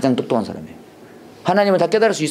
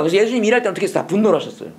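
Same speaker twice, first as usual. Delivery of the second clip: ordinary talk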